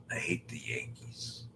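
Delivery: hushed conspiratorial whisper. Real, not acted. Dodger fan whispering to chat about hating the Yankees. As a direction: whisper it like a secret.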